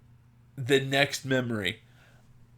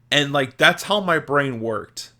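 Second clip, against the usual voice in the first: normal talking voice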